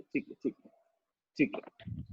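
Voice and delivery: ठीक है said softly, last one whispered; fading to a whisper. Shown as a demonstration. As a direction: drop to a hush. Teacher calming the class. (धीरे से, फुसफुसाते हुए ठीक है)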